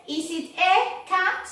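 put-on teacher spelling out "Is it a cat?" for young learners; slow model question. Shown as a demonstration. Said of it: "lo que tenemos que enfatizar".

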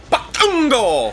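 loud, high, choked mock-Korean shout. Shown as a demonstration. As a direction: choke out fake Korean, drawn out. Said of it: "choke a little bit when you say it".

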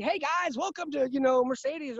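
high, peppy fake salesman greeting; mock bubbly salesman voice; bouncy salesman. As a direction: overeager car-lot cheer. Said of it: "I'm not bibbly bounce, bouncy".

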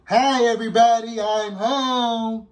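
high, very loud greeting; full-volume hello to the neighbors; turned right up. Acted. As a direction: blast it through the building. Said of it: "she turns it right up".

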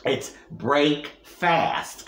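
very slow, break fast said as two words, stress on break; slow, split word. Shown as a demonstration. Explaining breakfast to viewers, slow and drawn out. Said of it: "Why do they call it breakfast".

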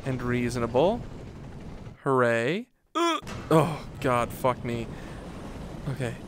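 ordinary talk, nothing marked

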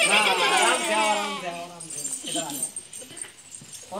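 soft, slow, calming call that fades away; gentle coaxing. Real, not acted. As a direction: draw the animal in gently. Coaxing the monkey close.

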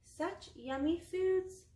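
ordinary delivery, nothing marked

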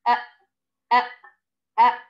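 أ clipped short with sukun; sukun hamza. Shown as a demonstration. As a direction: cut the أ off short, no vowel after. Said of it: "كيف يقرأ؟". (همزة ساكنة قصيرة)

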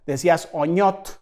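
Oñot, Toño said backwards; backwards speech. Shown as a demonstration. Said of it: "en lugar de decir Toño".

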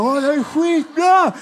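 high, loud, slow gushing shout; high, loud, slow teen friends gushing; excited mock teens. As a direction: cheer like wowed friends.

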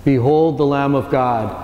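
ordinary delivery

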